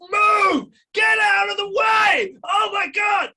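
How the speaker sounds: high, loud shouted outburst, slow on get out; mock scream. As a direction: yell it like the screaming in your head. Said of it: "In my head, I'm screaming".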